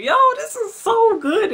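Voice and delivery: high, loud delighted squeal; squealing excitement. Real, not acted. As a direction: squeal with joy. Raving about ice cream.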